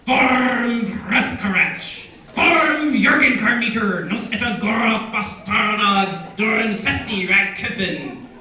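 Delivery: loud, slow, throaty Dwarvish declamation; hoarse Dwarvish proclamation with heavily rolled R's. Shown as a demonstration. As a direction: sink your teeth into meaty Dwarvish, uvular rolled R's. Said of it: "it has a great throaty, meaty feel".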